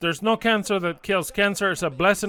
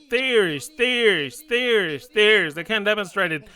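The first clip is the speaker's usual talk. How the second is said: fiery preaching; 4 semitones higher than usual. Real, not acted. Preaching at full force.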